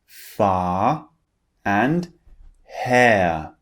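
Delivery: far and hair with no final R; British English vowels, no R. Shown as a demonstration. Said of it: "The R sound is not pronounced".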